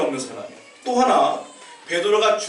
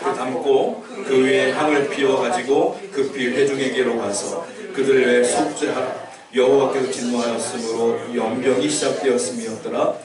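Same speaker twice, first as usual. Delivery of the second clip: low, measured congregational Bible reading; scripture reading aloud; 4 semitones lower than usual. Real, not acted. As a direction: read the verse out evenly, leading the congregation. (낮고 고르게 성경 봉독)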